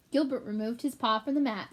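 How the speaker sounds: usual speaking voice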